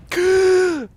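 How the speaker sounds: high hammed-up gasp; a gasp of pretend horror at the plot; mock shocked gasp. Gamer mocking the game's plot, hammed-up gasp.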